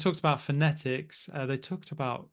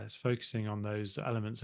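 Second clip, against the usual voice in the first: ordinary talk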